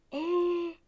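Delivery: sharp gasp of delight. Real, not acted. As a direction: gasp in awe at the decor. Seeing the new planter pots.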